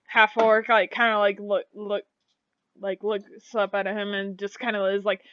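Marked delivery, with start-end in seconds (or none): none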